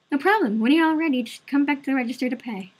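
waitress voice. Acted. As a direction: play the friendly waitress up high.

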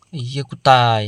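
ordinary talk, nothing marked

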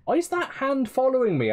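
high-pitched frustrated complaint; real gamer exasperation; exasperated. Real, not acted. Exasperated at the game.

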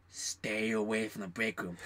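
slow, low, softened retold warning. Friend warns coworker away from break room, slow warning. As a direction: draw it out low, as a grave caution.

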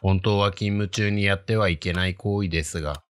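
ordinary delivery, nothing marked

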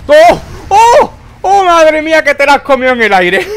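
loud, high triumphant yells; excited shouting. Real, not acted. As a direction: shout it out in pure delight. Excited gamer kill reaction. (gritos de emoción al ganar)

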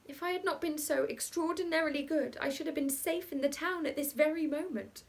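a hint of a frightened Bertha; frightened little girl. A narrator's reading. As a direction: regret it like a scared good girl. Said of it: "and thought to herself".

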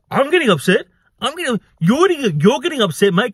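high, indignant throwing back of blame; mock outrage in a retold argument; imitated indignant retort. Acting out man's retort in quarrel.

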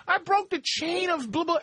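mocking imitation. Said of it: "You two go".

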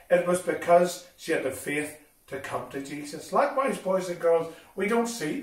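normal talking voice

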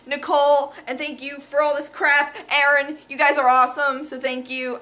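ordinary talk, nothing marked

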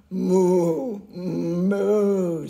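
loud lowing moo; loud cow moo. A narrator's reading. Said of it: "said the spotted cow".

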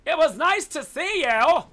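loud, high, slow sing-out hello; drawn-out high-pitched gloating hello; taunting greeting. Real, not acted. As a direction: call it out with mock friendliness.

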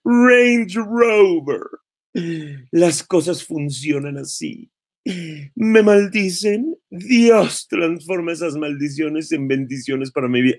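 high, slow mocking read of her post; mocking quote. Vlogger mocking a rival's post, high sing-song parody.

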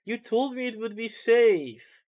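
high, loud, slow whining; whiny complaint. Real, not acted. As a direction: drag out the protest, betrayed. Complains friends lied about safety.

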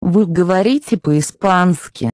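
ordinary delivery

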